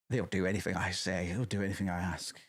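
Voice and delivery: low, soft scheming voice; mock villain murmur. Imitating a megalomaniac admin, low and soft. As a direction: purr it like a petty tyrant.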